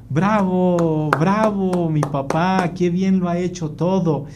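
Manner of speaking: drawn-out mock applause; sarcastic praise. Wife greeting husband after outburst, sarcastic bravo. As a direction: praise with slow, stretched irony.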